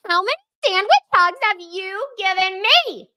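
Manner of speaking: high put-on voice of a squished friend; a teasing character voice; mock Lindsay voice. Host jokes in a high put-on voice about the group hug.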